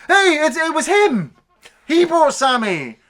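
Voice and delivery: high, loud mock accusation; accusing shout. Film's bully blaming Eddie to the cops, accusing shout. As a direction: call out like a bully pointing the finger.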